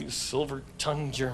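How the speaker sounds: low, soft flattered murmur; mock swoon. Host flattered by the doctor, low swooning murmur. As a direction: melt under the compliment.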